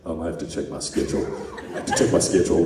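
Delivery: low, dopey mock voice of a busy student; imitating Jeff. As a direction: drop the voice low and drag out the excuse. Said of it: "And he'd say".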